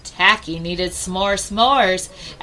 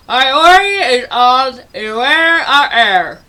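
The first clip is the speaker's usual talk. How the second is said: louder, slower words through a sticky mouthful; mouth full of s'more; 2 semitones higher, 8 dB louder, slower than usual. Acted. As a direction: talk around a stuck beak full of candy. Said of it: "He unstuck his s'more be".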